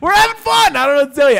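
high, loud comic protest; mock defensive shout. Podcaster defending the episode to co-host, high mock shout. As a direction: yell it in playful exasperation.

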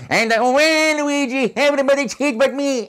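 hoge, zeurende Luigi-stem, traag. Shown as a demonstration. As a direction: jammer hoog als Luigi. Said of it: "and Luigi".

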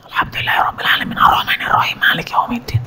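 fast, soft whisper of the prayer verses; whispered recitation. Shown as a demonstration. Teacher shows how to recite quietly beside others, whispering. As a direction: breathe the words out without voice, quickly. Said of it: "maka tidak boleh kita bacanya keras".

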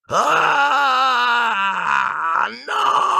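high shout of terror from a nightmare; sudden panicked scream; terrified cry. Acted. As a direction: cry out in fright. Harry wakes from nightmare.

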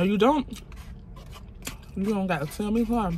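usual speaking voice

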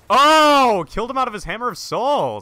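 loud, high whoop of triumph; exultant shout. Real, not acted. Player downing a powered-up opponent, exultant shout. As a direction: burst out loud and high, thrilled by the kill.

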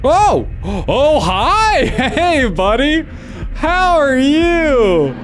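butter up the killer with fake cheer; mock friendly. Player sweet-talks the killer Santa, slow mock friendliness.

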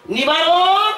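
calling out. Acted. Doctor calls his servant. (জোরে ডাক)

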